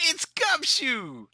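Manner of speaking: high, drawn-out delighted announcement; gleeful high-pitched character call; excited greeting. Acted. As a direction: announce a familiar face with a big stretched cry. Character greets the detective's arrival.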